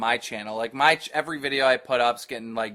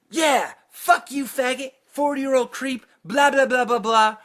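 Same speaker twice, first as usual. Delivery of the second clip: mocking heckler; 11 semitones higher, 4 dB louder than usual. Host imitates the graphics guy chirping in, high mocking jeer. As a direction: parody a chirping troll, pitched way up.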